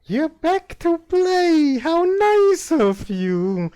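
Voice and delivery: put-on high, slow, loud eerie child voice; a ghost child singing out delighted; creepy little girl ghost.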